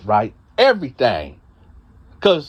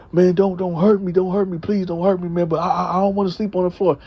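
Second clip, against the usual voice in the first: high, fast pleading cellmate; whiny begging; 6 semitones higher, faster than usual. Retold or imitated. Frightened cellmate whimpering for mercy. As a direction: cellmate begging the storyteller not to hurt him, high pleading. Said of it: "He was like".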